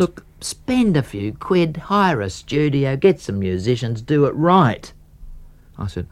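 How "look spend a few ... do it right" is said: higher, slow stern advice; Dutch uncle. Retold or imitated. A mentor telling him to hire a studio, stern and slow. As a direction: lecture firmly like a stern uncle. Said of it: "talked to me like a Dutch uncle".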